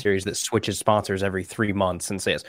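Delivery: ordinary talk, nothing marked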